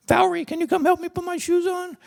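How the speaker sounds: high, put-on helpless voice calling out; helpless plea. Husband stuck in a stiff uniform calls wife, high helpless plea. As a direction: play the stuck husband begging for help.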